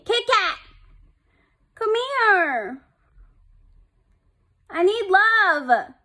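high, pleading pet call; calling the cat. Real, not acted. Calling back Kit Kat who left her, high and pleading. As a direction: call out high, begging for affection.

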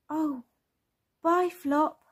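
high-pitched storybook little one; high, childlike farewell; child character voice. Acted. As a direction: call goodbye as a small child. Storyteller voices Bing saying goodbye.

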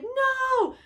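high, loud drawn-out no; desperate husband screaming no; anguished cry. Retold or imitated. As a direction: cry out in despair. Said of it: "Marlin's like".

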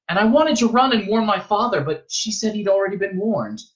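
put-on high-pitched, aggrieved boy; boy recounting a quarrel; young Paul, indignant. A narrator's reading. Young Paul retells the argument.

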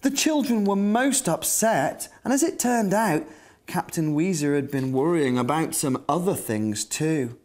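ordinary talk, nothing marked

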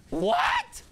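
high, loud disbelieving "what"; can't believe what you just heard; stunned, rising "What?"; shocked what. Real, not acted. Reacting to shocking news.

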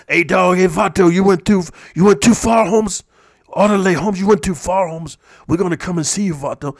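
mild mock Chicano gangster accent; Chicano homie accent. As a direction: lean into the vato slang, lightly. Imitating Chicano gang member.

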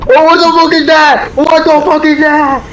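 alarmed shout. Real, not acted. Sees monster in game.

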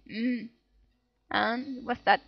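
usual speaking voice

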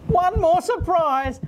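high, slow, drawn-out gasp of surprise; mock surprise. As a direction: play up the surprise for the kids. Host feigns surprise on air.

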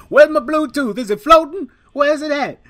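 high, loud frantic voice imitating him; panicky friend. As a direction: squeal it out like he's lost everything. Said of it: "So he's like".

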